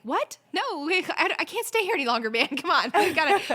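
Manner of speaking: loud, high pleading outburst; exasperated plea. Retold or imitated. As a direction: beg to leave, laughing through it. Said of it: "And I'm like".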